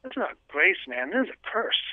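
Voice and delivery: high, disbelieving protest, grace; incredulous. Retold or imitated. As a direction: scoff at the idea like it's absurd. Retelling his shocked reply to priest.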